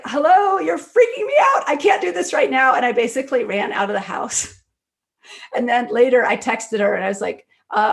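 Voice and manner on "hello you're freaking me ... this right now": high, loud, frightened retold cry of alarm; panicked shout. Friend alone in the house, shouting at an invisible ghost in a panic. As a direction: yelp it out as if spooked by an unseen voice.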